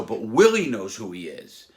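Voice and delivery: normal talking voice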